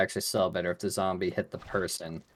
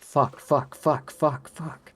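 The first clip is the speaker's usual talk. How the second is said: high, rapid-fire repeated bursts; blurred game sound; 7 semitones higher, 6 dB louder, much slower than usual. Shown as a demonstration. Showing what spam-selecting Fox sounds like, high repeated bursts. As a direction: imitate a spammed voice clip, loud and high. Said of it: "blurs into sounding just like".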